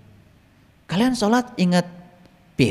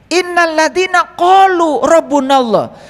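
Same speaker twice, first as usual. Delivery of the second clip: Quran recitation; 8 semitones higher, 5 dB louder, slower than usual. Acted.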